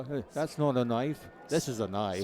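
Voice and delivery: light Australian accent, movie-quote impression; Crocodile Dundee. Broadcaster trying the Aussie accent, Australian movie impression. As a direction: attempt an Aussie accent for the film line. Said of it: "I can do crocodile".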